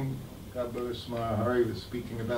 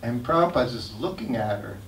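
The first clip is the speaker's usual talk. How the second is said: usual speaking voice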